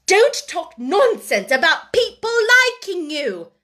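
high, loud scolding; scornful rebuke. A narrator's reading. Headmistress dismisses talk of being liked, scornfully. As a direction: cut the child's hope down sharply.

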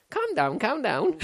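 put-on Scouse accent. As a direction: drawl it out like a Scouser. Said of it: "are you gonna do an impression of it?".

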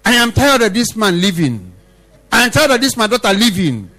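high, forceful pronouncement from God; God's declaration. Acted. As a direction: thunder it out as God's verdict. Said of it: "And God said, one day say".

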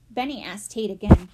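usual speaking voice

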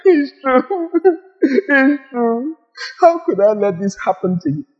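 high, sing-song mock sympathy; a hint of a pitying comforter. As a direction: coo over them in fake pity. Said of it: "God doesn't stay and say".

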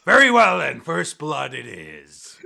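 booming start, long drawn-out finish; captain's announcement. Acted. Captain announcing the duel terms, loud and drawn out. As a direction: proclaim it to the whole crowd. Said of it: "And the captain goes".

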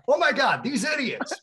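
high, loud mock outburst of disgust; imitating a colleague's loud exasperation; exasperated outburst. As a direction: blurt it out, fed up. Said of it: "Scott's like".

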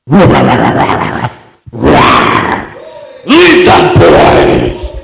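low, thundering command; commanding shout. Retold or imitated. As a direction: bellow it like a voice from above. Commanding voice in the vision.

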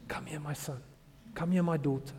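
soft, loving fatherly call; tender invitation. Retold or imitated. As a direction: draw a child gently into a hug. Retold Jesus calling his children close.

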